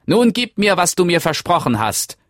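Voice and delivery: demanding little man. Acted. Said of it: "verlangte es".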